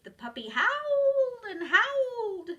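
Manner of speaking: high, loud howl-like reading. A narrator's reading. Narrator mimicking the puppy's howl, high and loud.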